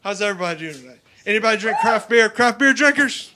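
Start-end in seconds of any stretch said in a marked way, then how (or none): 1.2-3.3 crowd call-out. Real, not acted. Calling out to the audience.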